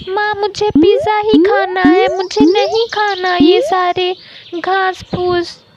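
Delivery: put-on high, insistent little-boy pestering; pushy, high-pitched kid's tantrum; stubborn child demanding. A narrator's reading. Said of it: "चीकू की इतनी ज़्यादा ज़िद देखकर".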